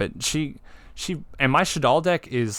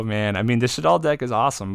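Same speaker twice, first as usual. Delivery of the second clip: ordinary talk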